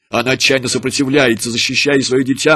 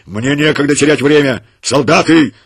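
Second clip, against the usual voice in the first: higher, loud barked commands; rough orders; 4 semitones higher, 7 dB louder than usual. A narrator's reading. Commander barking orders at his soldiers, high and loud. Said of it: "грубо ответил начальник отряда".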